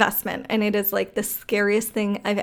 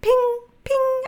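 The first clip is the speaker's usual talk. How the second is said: high-pitched ping, twice; imitated phone alert. Imitating a message arriving on the phone, high ping. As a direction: sing out a high phone chime.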